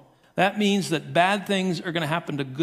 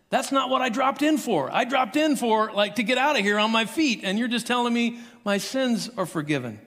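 higher, laughing disbelief; baffled paralyzed man; 5 semitones higher than usual. Retold or imitated. Man on the mat protesting to Jesus, higher laughing disbelief. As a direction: play the man let down, half laughing. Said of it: "the man on the mat kinda goes".

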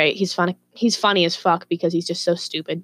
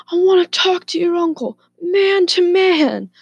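put-on high, loud drunken demand, dragged out at the end; mock a drunk man calling someone out; pitched-up drunk bluster; imitated drunk neighbour; 11 semitones higher, 5 dB louder than usual. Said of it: "he was drunk as fuck".

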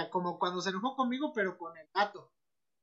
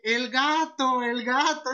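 mock wail; 4 semitones higher, 6 dB louder, much slower than usual. Friend blaming the cat.

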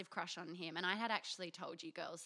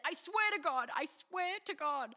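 pleading; 7 semitones higher, 4 dB louder than usual. Retold or imitated. Friend protesting innocence.

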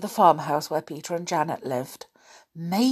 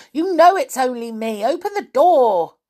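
loud, high demand to open; impatient shout at friends inside; 8 semitones higher, 8 dB louder than usual. Acted. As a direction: bang on the door with your voice. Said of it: "banged again impatiently".

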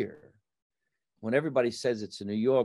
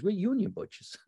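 retold butcher; 10 semitones higher than usual. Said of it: "he goes".

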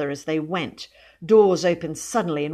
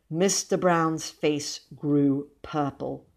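ordinary delivery, nothing marked

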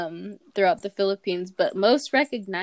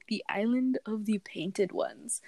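whispering; 7 dB quieter than usual. Real, not acted. Translation dropped to a whisper.

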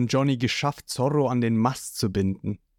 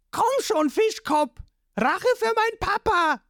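put-on boy's yell. A narrator's reading. The boy Jabo attacks Lysop, high angry yell. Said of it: "schreit der Junge".

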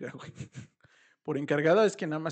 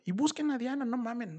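mock yell; 9 semitones higher than usual. Family shouting orders to find the girl, mock yell. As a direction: bark the order to search. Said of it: "Y empiezan a gritar".

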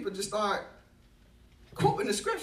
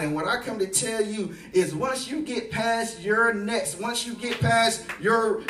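rising, louder preacher's climax; preaching at full force; 7 dB louder than usual. Acted. Preacher rallying the congregation toward their calling, at full force. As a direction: drive it home to the congregation.

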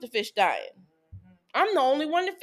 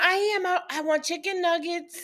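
put-on whiny child; 8 semitones higher than usual. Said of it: "she in the background".

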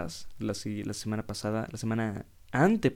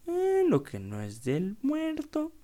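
much higher, slow mock old-timer voice; old man's saying; much slower than usual. Ex-mayor quoting a folksy phrase, high, slow mock voice. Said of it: "y el señor dice".

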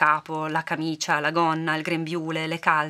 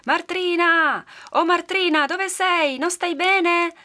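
high, loud long-distance call; calling out loudly; 12 semitones higher, 5 dB louder, much slower than usual. A narrator's reading. Villagers searching for missing Martrina, calling out loudly. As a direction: holler as if into the woods. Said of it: "iniziarono a gridare con le mani alla bocca".

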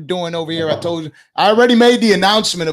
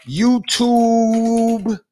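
slow spelled out; much slower than usual. Shown as a demonstration. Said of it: "spelling out the link slowly".

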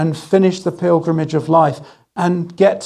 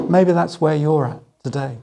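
ordinary talk, nothing marked

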